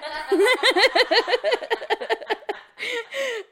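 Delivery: long high real laughter. Real, not acted. Friend cracking up at the joke, long laugh.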